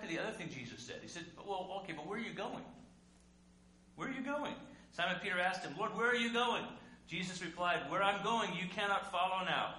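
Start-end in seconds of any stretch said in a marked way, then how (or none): 1.5-4.5 pleading question. Acted. Preacher voicing Peter's pleading question. 5.8-6.6 loud plea. Acted. Peter crying out to Jesus, loud urgent plea. 7.9-9.7 firm loud answer. Acted. Preacher voicing Jesus' firm answer.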